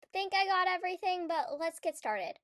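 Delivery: usual speaking voice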